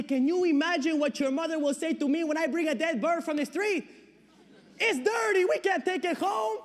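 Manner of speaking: high, loud fatherly protest; exasperated scolding. Acted. Father refuses to bring a dead bird home, exasperated and rising. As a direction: build to an exasperated high shout.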